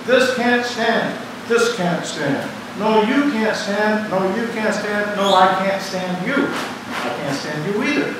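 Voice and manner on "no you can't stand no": usual speaking voice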